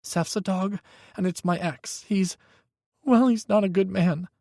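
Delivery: put-on high, tense young voice; a frightened young man pleading on the phone; young man, scared. A narrator's reading. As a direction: Micah telling Hawk his ex is dangerous, high and scared.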